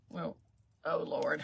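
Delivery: normal talking voice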